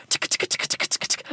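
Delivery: soft unvoiced tick tick; whispered ticking. Host mimicking a ticking clock, whispered. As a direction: tick like a clock under your breath.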